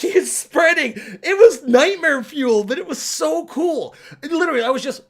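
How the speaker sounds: thrilled and creeped out; excited awe. Real, not acted. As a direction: genuine amazed rave. Raving about trippy scene.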